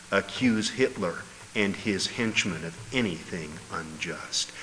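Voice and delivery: normal talking voice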